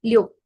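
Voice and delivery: "Liu" sharply falling in fourth tone with a rounded O-like U; fourth tone demo. Shown as a demonstration. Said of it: "aquí el seis es en cuarto".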